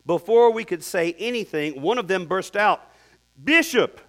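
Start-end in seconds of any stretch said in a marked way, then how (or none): 3.4-4.0 put-on a loud, high call of "Bishop!" in a light Ugandan accent. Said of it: "That's my only Ugandan accent for today".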